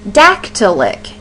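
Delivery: "dactylic" with DAC punched, two light syllables after; stressed first syllable. Shown as a demonstration. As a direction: hit the start of "dactylic", lighten the rest. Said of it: "is how that would sound".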